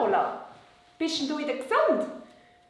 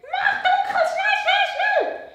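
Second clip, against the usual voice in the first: high, louder puppet urging; excited puppet voice; 7 dB louder, slower than usual. Acted. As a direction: hurry him in a high puppet voice. Puppet Lola urges.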